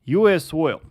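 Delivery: ordinary talk, nothing marked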